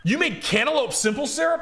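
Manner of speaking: high, loud mock astonishment; incredulous. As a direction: squeal it in disbelief, hamming it up. Mimicking drunk woman's incredulous reaction.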